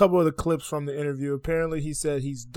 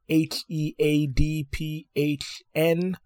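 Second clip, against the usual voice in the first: spelled out slowly; much slower than usual. Shown as a demonstration. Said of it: "spelling out his moniker".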